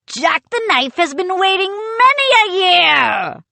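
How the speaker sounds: high, rousing pirate cry; crying pirate puppet. A narrator's reading. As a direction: crow it out like a swaggering toy pirate. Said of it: "the little figure cried".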